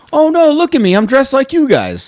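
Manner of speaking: high, goofy tourist voice. Retold or imitated. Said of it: "he's a racist that's like".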